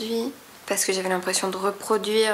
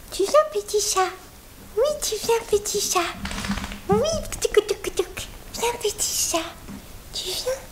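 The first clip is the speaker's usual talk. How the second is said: put-on much higher, coaxing baby talk; high cutesy pet-talk voice; little voice for the cat; 12 semitones higher than usual. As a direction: call the kitty in a tiny high voice. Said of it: "quand je prends une petite voix".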